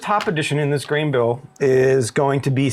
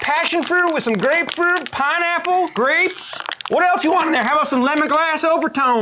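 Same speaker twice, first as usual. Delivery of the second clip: much higher, comic squeaky voice; high put-on voice; 11 semitones higher than usual. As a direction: list it all in a silly high voice. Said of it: "that is your voice".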